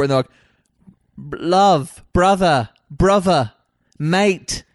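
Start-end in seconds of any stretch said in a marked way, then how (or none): 1.3-4.6 British bouncer. Retold or imitated. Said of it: "real condescending".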